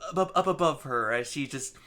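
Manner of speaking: normal talking voice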